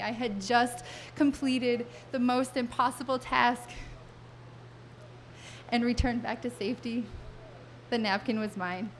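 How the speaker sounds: ordinary delivery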